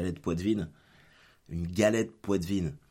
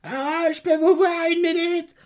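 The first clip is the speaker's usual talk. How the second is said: shrill high, loud boss call; nagging manager; 9 dB louder than usual. Retold or imitated. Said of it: "Tout le temps en train de m'appeler".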